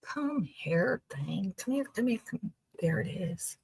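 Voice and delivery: soft unvoiced whisper, coaxing. Real, not acted. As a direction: coax it closer in a hushed whisper. Coaxing something on screen closer.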